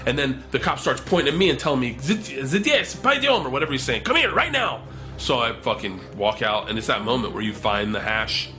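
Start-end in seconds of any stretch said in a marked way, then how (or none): 2.0-3.5 high, imitated Russian barking; mock shouted order. Storyteller as cop pointing at him, mock shouted order. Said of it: "they're just shouting". 4.0-4.8 very high-pitched imitated command; mock shouted order. Storyteller as cop summoning him, mock shouted order.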